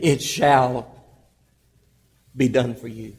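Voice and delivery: "shall" held, then a pause; firm stress on shall. Shown as a demonstration. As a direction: land on "shall" and pause before going on. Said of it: "He said".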